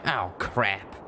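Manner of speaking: sharp, pained gasp of a curse; a hint of a hurt gunslinger's hiss. A narrator's reading. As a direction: wince it out through the pain. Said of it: "Calamity hissed".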